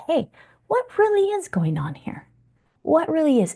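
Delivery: high, wondering curious tone. Shown as a demonstration. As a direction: ask with genuine curiosity. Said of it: "we got curious".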